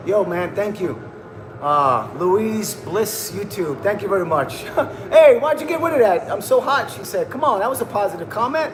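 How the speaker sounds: ordinary talk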